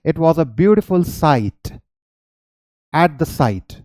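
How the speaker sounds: slow, careful demo sentence; teacher demonstrating a sentence; slow example sentence. Shown as a demonstration. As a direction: read the example slowly. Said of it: "example sentence read".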